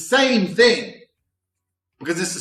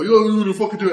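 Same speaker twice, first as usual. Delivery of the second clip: loud, higher street challenge; mock aggressive challenge; 6 semitones higher, 5 dB louder, faster than usual. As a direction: bark it like a bar thug. Thugs challenge to fight.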